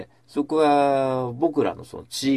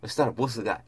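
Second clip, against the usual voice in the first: ordinary delivery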